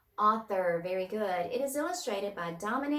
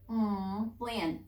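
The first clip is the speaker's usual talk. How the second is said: ordinary talk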